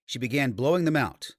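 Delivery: usual speaking voice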